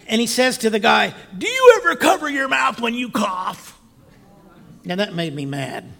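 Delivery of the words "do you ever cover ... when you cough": high, loud mock shout; acted scolding. Passenger leans over seat at coughing old man, scolding shout. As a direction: lay into him like an irritated passenger.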